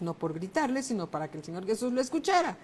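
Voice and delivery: ordinary talk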